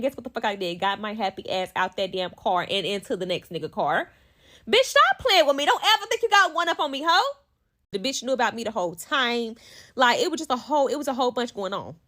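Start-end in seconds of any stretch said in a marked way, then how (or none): 4.7-7.4 high, fast, sassy telling-off; scolding taunt. Acted. Woman warning her rival, high heated scolding. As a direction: tell the rival off in a high rush. Mock confrontation addressed to the rival.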